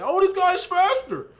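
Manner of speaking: high, drawn-out mock whine of an online troll; dead-serious trolls. Mimicking trolls who argued over card speed, mock-earnest whine. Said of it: "like, dead serious that".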